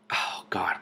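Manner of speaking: unvoiced hushed aside; whisper. Real, not acted. Reader bracing before the silent cave, whispering. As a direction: breathe it out in dread.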